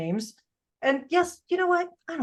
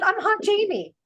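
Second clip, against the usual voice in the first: high-pitched self-naming announcement; insistent declaration; 11 semitones higher than usual. Retold or imitated. Mom counters daughter with her own nickname, high insistent declaration.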